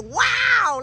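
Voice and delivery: a high, thrilled wow; high bright burst of joy; excited wow. Retold or imitated. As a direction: gasp it out, delighted. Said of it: "Like, I was so excited".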